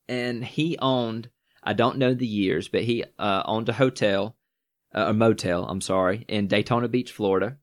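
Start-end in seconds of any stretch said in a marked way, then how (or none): none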